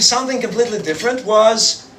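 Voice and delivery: normal talking voice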